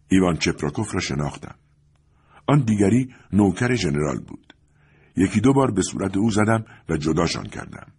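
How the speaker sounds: plain reading voice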